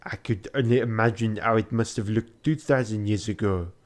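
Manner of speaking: ordinary delivery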